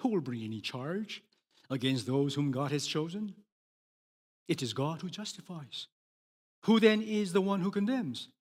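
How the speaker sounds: ordinary delivery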